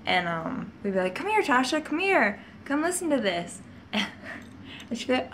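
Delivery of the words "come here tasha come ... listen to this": high, sing-song coaxing; luring sister. Retold or imitated. Older sisters luring little sister to the scary noise, high coaxing. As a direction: lure the little one over sweetly.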